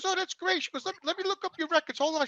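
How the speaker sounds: lawyer's caller, high and soft; lady caller. Retold or imitated. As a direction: pitch up for the woman on the line. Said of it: "She says".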